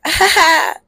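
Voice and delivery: a high, loud, hammed-up rapper laugh; bursting copy of the rapper's laugh. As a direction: mimic his trademark laugh, loud and high. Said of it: "with the laugh".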